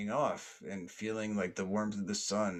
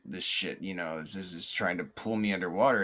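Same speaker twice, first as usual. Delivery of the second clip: plain reading voice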